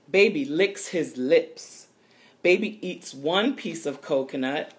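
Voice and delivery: lively storybook read-aloud for little ones; reading to children. A narrator's reading. Reading a picture book to listening children, lively storytelling voice. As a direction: read it brightly, like story time.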